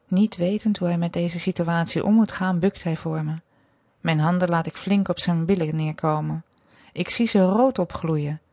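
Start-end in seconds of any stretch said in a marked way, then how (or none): none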